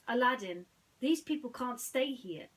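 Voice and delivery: loud, firm protest; Jasmine, firm. Acted. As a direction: put your foot down. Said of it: "Jasmine sighed".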